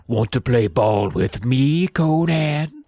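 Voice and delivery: slow, booming giant's challenge; slow mock threat to the bully; thundering giant. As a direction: loom over him as you ask. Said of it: "Evan thundered".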